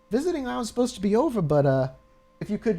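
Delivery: high, fast, put-on receptionist voice; nurse Susie voice. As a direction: play the nurse up high and quick.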